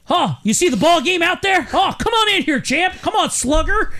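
high-pitched, hammed-up wholesome family voice; mock fifties family. Fifties family calls the champ inside, mock wholesome cheer. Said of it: "This family is just like".

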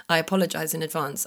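ordinary talk, nothing marked

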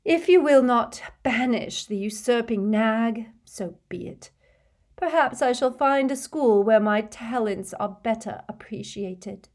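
Trelawney storming off, high and theatrical; offended seer. A narrator's reading. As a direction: sweep out with injured pride.

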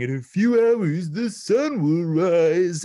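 high, loud, slow dramatic delivery; Tristan voice. Retold or imitated. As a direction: boom it out like a cartoon hero. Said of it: "just Tristan from Yu-Gi-Oh! Abridged saying".

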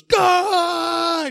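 high, drawn-out mock scream; frustrated movie-hero yell, imitated. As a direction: cry out in mock frustration, pitch way up. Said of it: "He's just, like, screaming".

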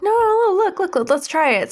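high, excited imitation of pleading; eager insistence. Dog urging skeptical Cat to try hypnosis, eagerly insistent.

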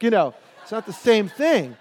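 normal talking voice

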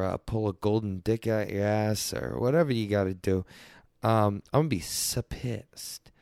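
ordinary talk, nothing marked

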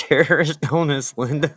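usual speaking voice

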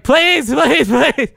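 very high, frantic mock pleading; retold shouting. Reenacting begging a coworker to flick the bee, high-pitched shout. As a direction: squeal the pleas in comic desperation. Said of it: "I was just shouting".

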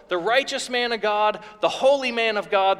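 usual speaking voice

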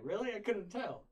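put-on deadpan the kid's cheap shot; sarcastic kid. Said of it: "he's like".

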